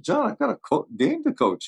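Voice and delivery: high-pitched mock protest. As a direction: squeak out the objection. Coach protesting, voiced high.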